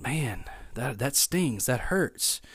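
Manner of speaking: soft, inward aside; private hurt. Acted. Secretly stung by a joke, quietly hurt. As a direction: mutter it to yourself, a touch wounded. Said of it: "But deep down, you're kinda thinking".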